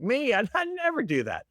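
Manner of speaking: high, sing-song fake innocence; mock innocent. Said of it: "I was like".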